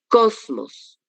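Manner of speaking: "cosmos" with weight on COS; stress on first omicron. Shown as a demonstration. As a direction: model COS-mos, falling at the end. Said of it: "el acento en la primera Omicron".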